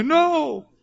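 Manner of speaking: very high frightened no; scared refusal. Retold or imitated. Boy refuses as dad grabs him, very high. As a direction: yelp out a refusal like a scared kid. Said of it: "And I'm going".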